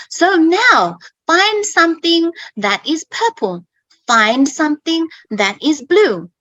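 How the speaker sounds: lightly put-on teacher voice, lilting key words; classroom instruction demo. Shown as a demonstration. Teacher setting a color-finding task, lightly put-on classroom voice. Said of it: "teach about color, I would say".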